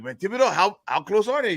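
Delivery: high Cajun buddy asking; Cajun joke character. Acted. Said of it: "get into this character here".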